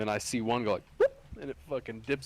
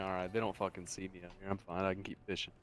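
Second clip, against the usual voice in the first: quiet inner thought; 6 semitones lower, 8 dB quieter, faster than usual. Retold or imitated. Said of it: "I'm like".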